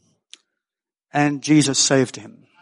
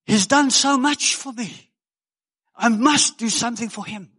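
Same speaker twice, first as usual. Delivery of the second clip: put-on high, earnest plea of an old man; imitating an anxious new convert; quoting Al; 7 semitones higher than usual. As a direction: act out Al's urgent words. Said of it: "And this is how he did".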